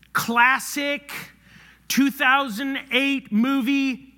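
normal talking voice

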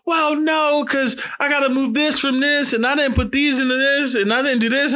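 high-pitched flustered mom impression; imitating mom's excuses. Acts out mom making excuses.

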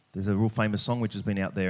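ordinary talk, nothing marked

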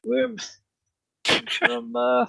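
high, drawn-out cartoon guard voice; accented town guard. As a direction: play a puzzled guard in a high put-on accent. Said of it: "That's quite the accent there".

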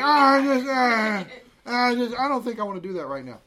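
lightly voiced reluctant child; whiny kid voice. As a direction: mimic a kid dodging chores. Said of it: "You're like".

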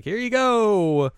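high, loud, drawn-out send-off; mock eagerness. Moogie about to try the guitar, playfully eager. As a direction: stretch it out in a high voice.

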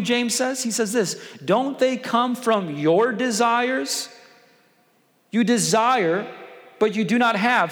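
fiery sermon delivery, loud and high; preaching at full force. Acted. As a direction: drive the scripture home from the pulpit.

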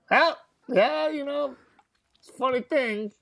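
high-pitched, hesitant retold reply; awkward placating reply. Young man answering the bully over his tattoo, awkwardly placating. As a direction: go high and tentative, stalling for words.

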